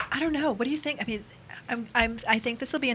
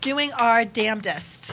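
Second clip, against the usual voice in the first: drawn out, very slow; slow emphatic; 4 dB louder, much slower than usual. Real, not acted. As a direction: stretch every word for effect. Slow emphatic repeat of phrase.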